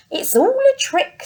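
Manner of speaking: very high, loud protest; indignant child. Acted. Gruffalo's child declaring it's all a trick, high and loud. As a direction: cry it out in disbelief. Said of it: "said the Gruffalo's child".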